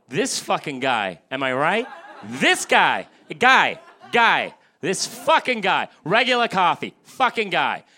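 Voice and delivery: mock Boston tough guy ranting in the coffee line; movie Boston mobster. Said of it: "that's how all mobsters talk in every fucking movie about Boston".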